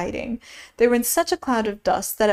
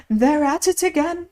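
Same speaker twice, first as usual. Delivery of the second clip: mild narrated shout, higher pitched; 6 semitones higher, 3 dB louder than usual. A narrator's reading. Messenger giving the king news, a mild shout. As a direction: call it out with restrained volume. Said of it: "shouted at the top of his voice".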